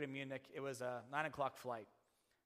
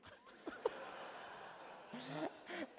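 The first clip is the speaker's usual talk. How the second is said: high breathy laugh. Real, not acted. Preacher laughing at the absurdity.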